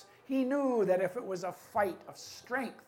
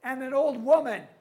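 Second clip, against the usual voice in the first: indignant, raised voice of a chief; loud chief; 3 semitones higher, 6 dB louder than usual. Acted. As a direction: complain loudly in disbelief. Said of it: "his voice was so loud".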